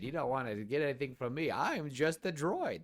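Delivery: sarcastic droid in a cartoon clown voice; John Mulaney. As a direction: draw it out like an old clown comedian. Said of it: "I made John Mulaney sound".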